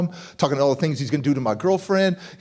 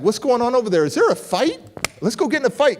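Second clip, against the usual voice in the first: eager for a fight; 6 semitones higher, faster than usual. Retold or imitated.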